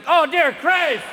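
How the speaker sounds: high, loud comic outcry; mock alarm. Sufferer breaking into a river dance, loud mock alarm. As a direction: cry out in mock horror. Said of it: "break out in the river dance?".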